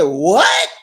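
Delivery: sharp startled "What?" thrown out loud; a loud shocked "What?" full of regret. Retold or imitated. Husband caught out, answering wife's news with shocked "What?". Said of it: "it's just the way he said it".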